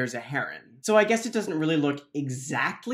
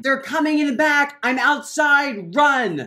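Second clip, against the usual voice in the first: high, loud warning; panicked shouted warning; urgent shout; 7 semitones higher, 5 dB louder than usual. Acted. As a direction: call out in alarm. Chased by monsters.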